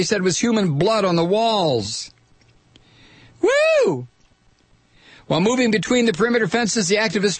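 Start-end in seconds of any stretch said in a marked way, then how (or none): 3.4-4.1 high whoop of delight; cheering whoop. Real, not acted. Cheering the activists' feat.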